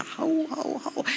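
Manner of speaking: high howling like a hunting dog. Shown as a demonstration. Preacher imitating his dog on a rabbit, howling. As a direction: howl up high, then fade to breath. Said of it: "they go into hyper pitch".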